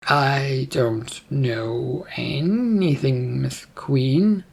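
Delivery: very slow, stretched-out words; dragging, evasive answer to royalty; drawn-out denial. Acted. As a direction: stretch every word as you feign ignorance. Mika denies knowledge to the queen.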